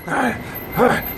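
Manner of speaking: short, repeated mock grunts; lightly imitated caveman sex sound; caveman grunting. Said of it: "Sound like a damn caveman".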